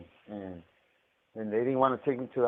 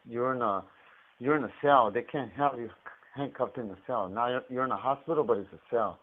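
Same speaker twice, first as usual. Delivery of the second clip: ordinary talk, nothing marked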